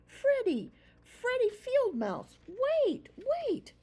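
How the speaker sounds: high, loud shouts down the road; calling out. A narrator's reading. Mouse runs after his friend, shouting wait. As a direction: call after him to stop.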